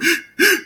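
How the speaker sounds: high, hitching gasp after crying; imitated post-cry gasp. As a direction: mimic a kid catching breath after sobbing. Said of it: "when you done crying".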